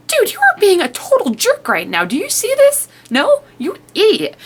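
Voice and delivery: high-pitched, indignant telling-off; exasperated scolding. Retold or imitated. Reader scolds Day for yelling at June, exasperated. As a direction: scold him in squeaky mock outrage.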